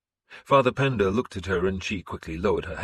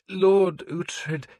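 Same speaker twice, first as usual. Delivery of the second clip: higher, careful priest voice; the priest probing carefully, higher voice; 11 semitones higher, 4 dB louder than usual. A narrator's reading. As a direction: tread lightly as the priest. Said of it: "he said carefully".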